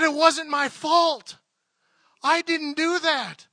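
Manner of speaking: slow, high, loud cry of realization; overwhelmed outcry. Retold or imitated. Woman learning she is blameless, overwhelmed outcry. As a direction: imitate a drawn-out, overwhelmed shout.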